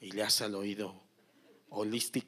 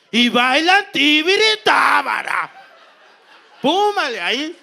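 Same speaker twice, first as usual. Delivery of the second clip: excited shout; 10 semitones higher, 13 dB louder than usual. Retold or imitated. Excited climax of massage story.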